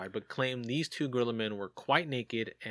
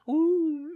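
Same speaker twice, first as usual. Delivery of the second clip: a high, loud creeped-out ooh; a sharp rising ooh of being spooked; 8 dB louder than usual. Real, not acted. Host imagines hearing whistling from the woods, high spooked ooh.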